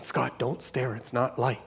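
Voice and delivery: a mother's quiet hushing warning to her son; hushed mom. Retold or imitated. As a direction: keep it low, like shushing a child in public. Mom hushing staring child.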